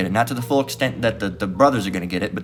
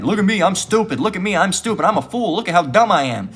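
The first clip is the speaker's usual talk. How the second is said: higher, sing-song mock of a fool; 7 semitones higher than usual. Preacher mocking scoffers as dunces, higher sing-song mock. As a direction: ham up a proud fool showing off. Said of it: "big old dunce cap on your head".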